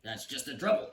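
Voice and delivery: mild bellow from a cranky king. Acted. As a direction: boom it out like an annoyed king. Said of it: "bellowed the king".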